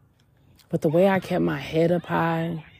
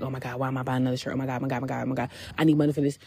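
rapid, hushed panicky muttering to oneself; retold panic; 3 semitones lower, 4 dB quieter, much faster than usual. Panicking to herself over buying another shirt, rapid hushed fretting. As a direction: imitate a mild panic spiral, words tumbling out low. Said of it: "all of a sudden I'd panic".

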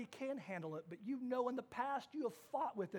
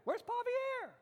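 worried call; 14 semitones higher than usual. Retold or imitated. Family searching for lost dog.

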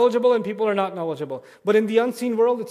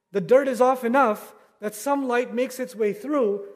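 building sermon fervor; 4 semitones higher than usual. Acted. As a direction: preach it with growing passion. Preacher builds to climax.

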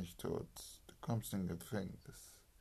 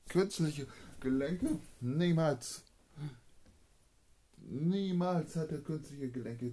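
loud, high astonished outcry; can't believe what he just heard; rising exclamation of shock; shocked disbelief; 6 semitones higher, 6 dB louder than usual. Acted. Shocked disbelief at artificial joints.

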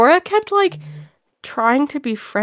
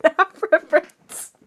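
high disbelieving squeak; can't believe what she's reading; incredulous; 8 semitones higher than usual. Real, not acted. Disbelief at dad's text.

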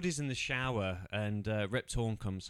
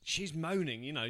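ordinary delivery

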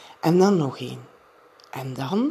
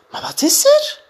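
much higher, louder, anxious mother's voice; worried mother; 8 dB louder than usual. A narrator's reading. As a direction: hurry in to a frightened child. Mother rushes in at night. (moeder komt bezorgd aangelopen, hoog en luider)

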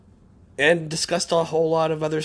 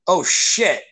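retold jolt of alarm, higher and louder; sudden realisation; 5 semitones higher, 6 dB louder than usual. Host recalling he forgot tonight's podcast, sudden realisation. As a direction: blurt it as if just remembering. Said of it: "I was like".

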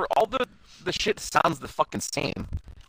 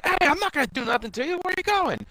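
much higher, pleading call after fleeing people; a strained, high plea not to run; pleading Itmon; 13 semitones higher than usual. Retold or imitated. Itmon calls after people running away, high and pleading.

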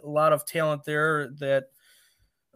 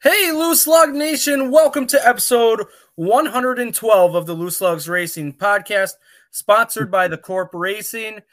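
high, loud show opener; hyped announcer; 6 semitones higher, 6 dB louder than usual. Acted. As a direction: kick off the show big and loud. Host's hyped show opening.

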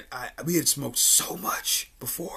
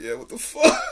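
laughing agreement; 10 semitones higher, 3 dB louder than usual. Retold or imitated. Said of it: "And I'm like".

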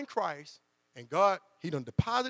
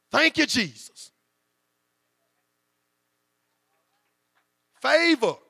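loud, higher, slow preaching shout; joyful shout; 5 semitones higher, 11 dB louder, slower than usual. Acted. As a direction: cry it out to the rafters in praise. Preacher celebrating answered prayer.

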